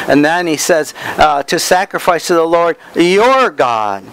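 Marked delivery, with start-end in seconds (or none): 1.4-4.0 YOUR lifted out before "God"; stress on YOUR. Shown as a demonstration. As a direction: hit YOUR so it sticks in the mind. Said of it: "I put emphasis on different words".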